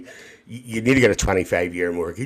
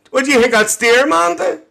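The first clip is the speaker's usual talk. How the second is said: ordinary talk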